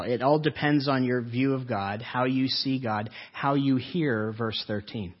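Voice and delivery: ordinary talk, nothing marked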